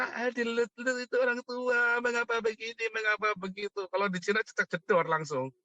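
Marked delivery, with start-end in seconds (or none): 0.0-3.9 put-on high whiny old-timer complaint; a high put-on voice whining like old people; mock old folks. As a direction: mimic fussy elders.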